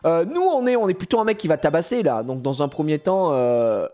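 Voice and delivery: normal talking voice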